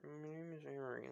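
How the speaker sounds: soft, indistinct mumbled introduction; mumbling. Acted. Shy girl telling Izuku her name, mumbling. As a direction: mumble it shyly under your breath. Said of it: "like, mumbling".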